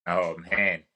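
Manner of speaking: usual speaking voice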